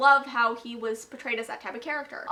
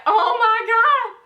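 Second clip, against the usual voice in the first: high, loud, drawn-out mock scream; scared reaction; 9 semitones higher, 8 dB louder, much slower than usual. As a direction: re-enact a reader's fright, stretched and shrieked. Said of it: "so scared".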